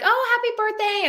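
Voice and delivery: high, sing-song birthday wish; mock cheerful cast wish; cheerful greeting. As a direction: greet the boy warmly. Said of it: "we were like".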